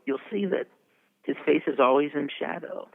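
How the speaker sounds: ordinary delivery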